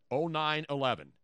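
ordinary talk

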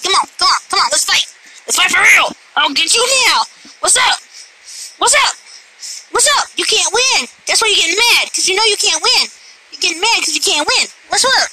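high, cocky trash talk; playful taunting. Pretend. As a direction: goad the cat into a play fight. Play-fighting taunts at cat.

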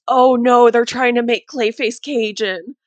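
a loud "oh no" as it clicks; dawning horror. Retold or imitated. Remembering the moment it clicked about Clayface, alarmed. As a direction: realize it all at once, alarmed. Sudden realization retold.

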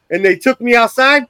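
ordinary talk, nothing marked

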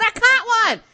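high, loud triumphant yell; excited shout. Retold or imitated.

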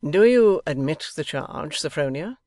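rally your wife like a playful prosecutor. A narrator's reading. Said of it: "in a rallying tone".

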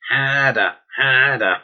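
exaggerated Boston accent, drawn-out open vowels; Boston accent impression. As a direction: call it out like a Boston girl in a film. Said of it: "The Boston girl in bedroom, going".

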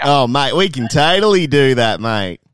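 hammed-up Australian accent; broad Australian accent. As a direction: overplay the Australian mate. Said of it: "broad Australian accents".

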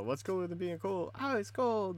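high, shouted crowd chant; call and response. Retold or imitated. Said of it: "'cause everybody would go".

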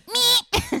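high, feeble little honk noise; imitated weak honk. Mocking her scooter's feeble horn, high squeak. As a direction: squeak out a sad toy horn. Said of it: "My honk is, like, so sad".

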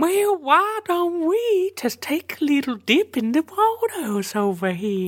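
very high, drawn-out singsong voice; coaxing creature. Acted. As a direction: coax them in a high, stretched-out voice. Coaxing figure suggests a swim.

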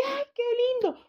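agudo y encantado; ternura exclamada. Retold or imitated. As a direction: exclama con ternura por el bebé. La gente reacciona enternecida. (exclamación enternecida y aguda)

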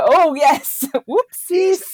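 laughing admission. Real, not acted. Admitting an awkward mix-up, laughing.

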